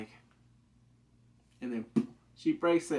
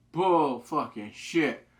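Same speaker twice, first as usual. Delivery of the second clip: slow, loud scornful call-out; real scoffing at the lie; disbelief; 7 dB louder, much slower than usual. Real, not acted. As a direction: spell out the disbelief word by word. Viewer reacting to venison claim.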